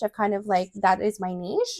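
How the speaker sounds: ordinary talk, nothing marked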